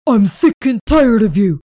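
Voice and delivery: loud, menacing demand; furious owner. Acted. Owner grabbing Spirit, raging at her. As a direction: threaten her for defying you.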